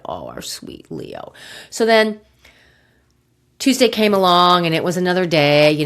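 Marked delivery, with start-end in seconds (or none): 0.1-1.3 soft sad sigh. Retold or imitated. Grieving the dying dog.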